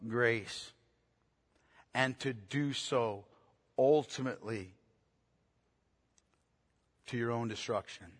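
low, soft and slow, with long pauses; solemn, hushed preacher letting each phrase sink in; hushed, weighty preaching. Real, not acted. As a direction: preach it low and grave, in measured pieces.

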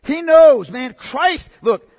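loud, slowing sermon build; fiery preaching. Real, not acted. Pastor exhorting his congregation, full-force preaching. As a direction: drive the point home to the church.

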